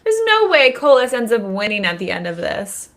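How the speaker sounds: loud, insistent outburst; emphatic objection. Real, not acted. Co-host rejecting a villain's victory, loud and adamant. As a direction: raise your voice and shut the idea down. Heated objection to co-host's theory.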